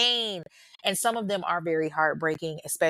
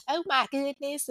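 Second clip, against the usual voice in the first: higher-pitched playful country accent; put-on country accent; 6 semitones higher than usual. Shown as a demonstration. Podcaster showing listeners the accents they try, in a country accent. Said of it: "and country accents and all of that".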